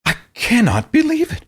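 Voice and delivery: high, stunned disbelief; can't believe what you just read. Real, not acted. Disbelief after quoting T-shirt slogan.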